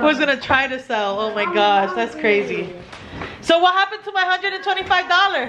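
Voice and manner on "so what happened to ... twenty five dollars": high, quick mock demand for payment; joking demand. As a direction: playfully chase them for the money. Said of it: "I'm just joking".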